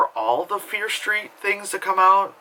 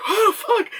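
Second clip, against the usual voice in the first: exhausted gasp; 4 dB louder than usual. Retold or imitated. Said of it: "and I'd be like".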